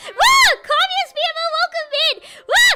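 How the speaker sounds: high, loud woo; excited cheer. Real, not acted. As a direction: whoop for the new follow. Cheer for follower.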